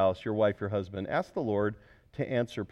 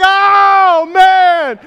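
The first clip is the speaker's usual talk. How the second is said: very high, loud astonished cry; stunned "oh man"; 23 dB louder than usual. Retold or imitated. Preacher reliving the car gift, loud astonished cry. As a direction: cry out in disbelief. Falling back in chair, stunned.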